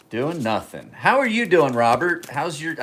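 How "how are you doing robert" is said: bright, high, loud hello to a regular; upbeat high call-out; cheery greeting. Real, not acted. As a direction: sing out the greeting to a viewer. Greeting a viewer in chat.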